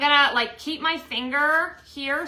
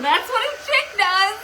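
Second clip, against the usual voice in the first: laughing; 11 semitones higher, 8 dB louder, slower than usual. Real, not acted. Laughing at husband.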